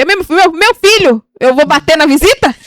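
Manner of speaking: loud, high, incredulous retort; exasperated rebuttal. Acted. Answering critics about hitting a guest, exasperated and loud. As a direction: throw it back in comic outrage. Mock rebuttal to objecting listeners.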